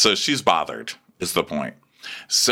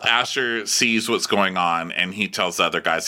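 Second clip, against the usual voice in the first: usual speaking voice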